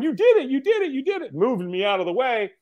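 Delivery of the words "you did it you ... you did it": high-pitched congratulating squeal; excited cheering. Retold or imitated. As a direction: cheer them on as the helper at the finish. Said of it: "she's like going".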